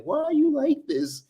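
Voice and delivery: high whiny mocking voice; simp voice. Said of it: "Y'all be on here trying to be like".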